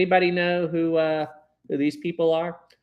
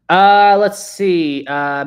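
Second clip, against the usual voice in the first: stretched, loud "let's see"; slow drawn-out; 4 semitones higher, 11 dB louder, much slower than usual. Real, not acted. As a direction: drag it out while thinking.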